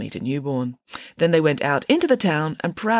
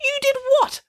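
very high, incredulous outburst; appalled accusation. A narrator's reading. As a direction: pitch it sky-high in disbelief. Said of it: "accused Judith Piggins".

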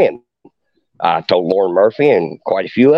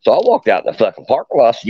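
ordinary talk

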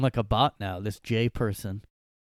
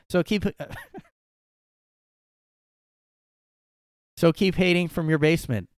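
raised, higher voice, defending hard; loud mock indignation; 6 semitones higher, 5 dB louder than usual. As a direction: read it like an offended fan. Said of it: "says Jay".

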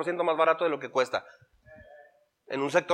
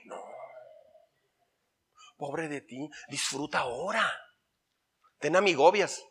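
much softer, coaxing inner voice; whisper-like temptation from the flesh; tempting flesh; 3 semitones lower, 9 dB quieter than usual. Retold or imitated. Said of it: "tu carne va a decir".

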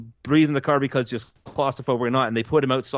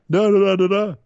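mock impression babble; 6 semitones higher, 6 dB louder than usual. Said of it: "here's my impression of this guy".

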